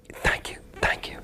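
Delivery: overcome, only a whisper left; breathless whisper. Retold or imitated. Thanking his mentor after weeping, whispered.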